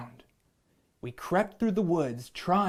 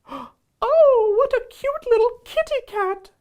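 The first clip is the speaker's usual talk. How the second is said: very high, louder cooing lady; a lady coos at the kitty cat in a very high voice; 9 dB louder than usual. Acted. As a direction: squeal it like a lady spotting a kitten. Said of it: "said the lady".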